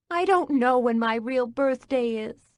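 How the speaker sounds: blurted out. Acted. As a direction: blurt it out, fed up. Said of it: "I blurted".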